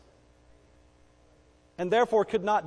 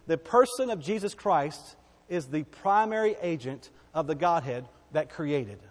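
ordinary delivery

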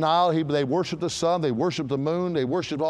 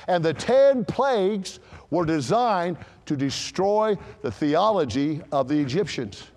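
ordinary talk, nothing marked

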